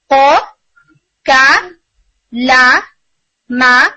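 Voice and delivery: قو، کا، لا، ما each said short and separate; syllable demo. Shown as a demonstration. Teacher lists example letters for the class, one by one. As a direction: tap out each voweled letter: قو، کا، لا، ما. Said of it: "جیسے".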